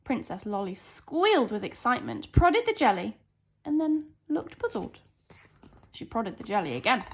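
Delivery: ordinary delivery, nothing marked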